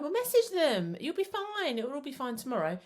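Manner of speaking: high, breezy brush-off: you'll be fine; breezy dismissive parent. Retold or imitated. Parent brushing off a teen's friend drama, high and breezy. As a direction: chirp it like the problem is nothing. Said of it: "us going in and going".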